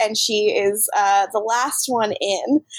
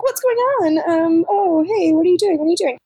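higher, jittery acted unease; spooky confusion; 6 semitones higher, 3 dB louder than usual. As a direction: play someone nervously surprised in the dark.

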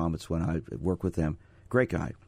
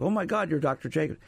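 higher-pitched imitation of a stunned kid; retold freaked-out amazement; 7 semitones higher than usual. Young referee recognizing the radio psychologist, retold freaked-out amazement. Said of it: "this kid almost freaked out".

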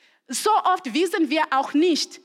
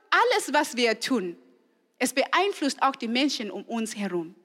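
normal talking voice

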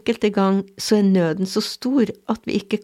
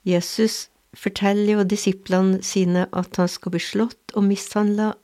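usual speaking voice

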